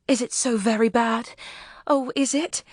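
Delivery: anxious pleading. Acted. Frightened memsahib asks the officer.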